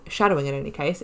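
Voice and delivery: normal talking voice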